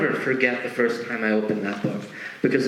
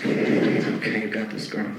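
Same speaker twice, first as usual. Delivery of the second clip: whispered pep talk; 5 semitones lower than usual. Retold or imitated. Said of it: "give herself a little bit of a pep talk".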